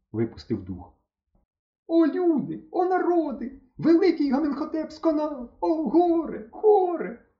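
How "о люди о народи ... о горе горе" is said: набагато вище, голосіння; заголоси, як плакальниця; відчайдушний лемент. A narrator's reading. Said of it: "отчайдушно заберещав Стьопа". (Стьопа відчайдушно голосить над фараоном)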